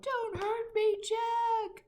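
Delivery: high, slow, loud begging cry; yelled plea. A narrator's reading. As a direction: beg for mercy in terror. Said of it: "he yelled".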